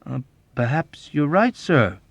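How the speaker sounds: crafty, deferential suggestion; sly suggestion. A narrator's reading. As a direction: float it slyly after a small hesitation. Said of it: "he suggested slyly".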